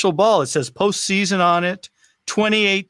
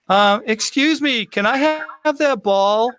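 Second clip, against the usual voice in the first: put-on higher, polite child's request; lightly voiced little kid, pitched up; 7 semitones higher than usual. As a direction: imitate a small boy shyly asking. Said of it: "this little kid walks down".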